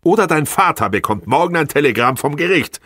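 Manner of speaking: high, loud accusing tirade; outraged. A narrator's reading. Wife threatening husband with the court, outraged. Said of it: "rief sie empört aus".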